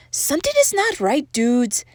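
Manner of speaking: high little character voice; small amigo voice. A narrator's reading. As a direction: sound the alarm as a tiny doggy. First small amigo speaks in story.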